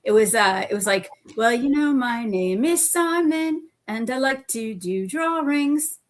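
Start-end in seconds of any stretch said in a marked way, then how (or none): 1.4-6.0 high, drawn-out, sing-song bad British accent; terrible British accent impersonation. Recalling a childhood cartoon for friends, in a terrible British accent. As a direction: do a hammed-up British kids' cartoon character, slow and high. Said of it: "this is my terrible impersonation".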